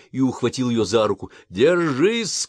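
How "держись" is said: high, loud rising shout. Acted. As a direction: cry out to her to hold on. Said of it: "крикнул он".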